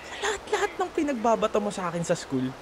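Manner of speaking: ordinary talk, nothing marked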